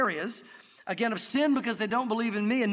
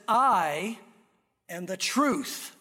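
weighty slow stress on "I" and "truth"; 2 semitones lower, much slower than usual. Shown as a demonstration. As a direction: hit "I" and land "truth". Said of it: "emphasizing those two words".

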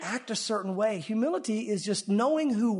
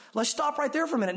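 ordinary delivery

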